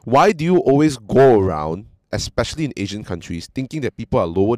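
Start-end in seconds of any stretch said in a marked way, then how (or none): none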